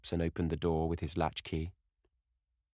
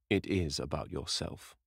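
grave, deep voice; 2 semitones lower than usual. Acted. The painter answers Dorian with grave solemnity. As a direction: weigh each word like a worried old friend. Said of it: "in his grave, deep voice".

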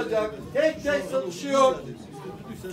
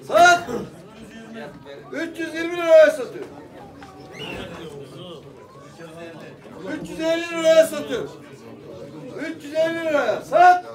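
auctioneer. Acted. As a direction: call the bids out like an auctioneer. Auction calling rising bids.

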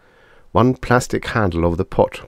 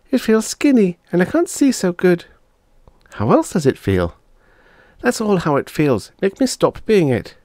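put-on girl turned chicken, complaining; 12 semitones higher than usual. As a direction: whine at your brother like a cross little girl.

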